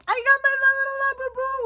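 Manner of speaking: showing off her new little Labubu, cutesy squeal. Real, not acted. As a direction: gush like it's the cutest thing ever.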